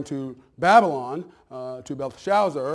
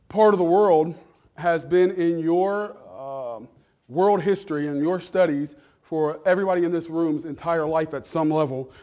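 usual speaking voice

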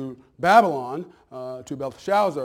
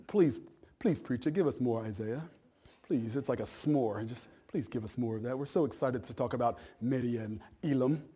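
pleading congregation in put-on British accent; mock British accent; 3 semitones lower, 6 dB quieter than usual. Pastor imitating his eager congregation begging for Isaiah, mock British accent. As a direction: beg softly in a mock British voice. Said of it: "I don't know why I'm British".

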